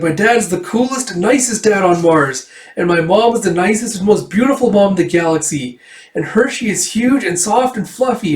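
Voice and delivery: put-on high-pitched, bragging kid voice; acted child's gushing pride; proudly boasting child. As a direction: brag in a high voice about your wonderful family. Voices Erica boasting of family.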